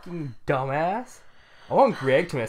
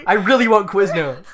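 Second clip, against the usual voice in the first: loud, higher mock whine; mock bratty demand; 5 semitones higher, 8 dB louder than usual. Joking text message to Cody about Quiznos. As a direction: demand it like a spoiled kid.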